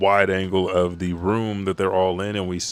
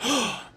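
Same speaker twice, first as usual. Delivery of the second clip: sharp high intake of breath; shocked gasp. Retold or imitated. Brook learns Shuri's secret, shocked gasp. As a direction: gasp in disbelief.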